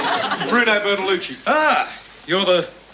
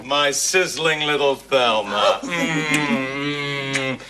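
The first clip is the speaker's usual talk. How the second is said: low, slow lustful purr; hammed-up seduction; 6 semitones lower, slower than usual. Uncle flirting with his sister-in-law, hammed-up low seduction. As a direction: croon it low with long savouring pauses.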